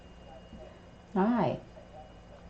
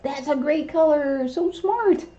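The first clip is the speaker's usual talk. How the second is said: excited praise; 6 semitones higher, 4 dB louder, slower than usual. Real, not acted. Praising parrot after it answers.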